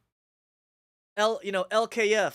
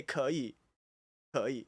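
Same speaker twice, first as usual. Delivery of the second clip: "可以" lower, in an understated Taiwanese accent; Taiwanese Mandarin word; 4 semitones lower, 4 dB quieter than usual. Shown as a demonstration. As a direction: say "可以" plainly, the Taiwanese way. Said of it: "But in Taiwan, you say".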